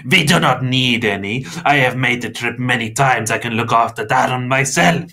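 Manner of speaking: forceful vampire objection; barking protest. A narrator's reading. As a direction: bark back, affronted. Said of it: "Mister Crepsley barked".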